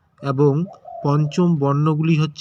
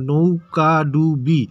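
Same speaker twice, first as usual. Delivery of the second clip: নৌকা ডুবি slow, each syllable separate; broken into syllables; much slower than usual. Shown as a demonstration. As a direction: chop নৌকা ডুবি into four drawn-out syllables. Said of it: "ভেঙে ভেঙে উচ্চারণ করতেছি".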